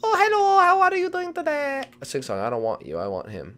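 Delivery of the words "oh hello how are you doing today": very high squeaky greeting; high-pitched game character. Acted. Character greeting the seeker politely, squeaky. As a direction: chirp it in a tiny voice.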